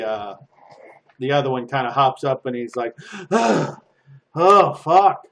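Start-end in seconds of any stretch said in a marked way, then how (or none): 3.3-5.3 loud, high panicked cursing; panicked prisoner. Acted. Caged prisoner panics and begs to be freed. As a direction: beg to be let out in a panic.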